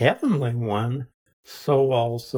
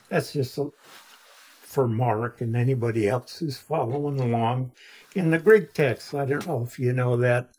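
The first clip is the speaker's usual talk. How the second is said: usual speaking voice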